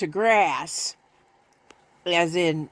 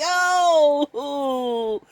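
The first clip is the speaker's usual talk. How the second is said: high, slow mock-sinister teasing; teasing kitten; 12 semitones higher, much slower than usual. Owner teasing her kitten about the vet. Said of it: "I'm like".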